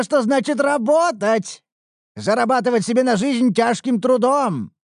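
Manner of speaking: high, loud mocking tone; smug lecture. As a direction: gloat like an old taskmaster. Mradish lectures her smugly.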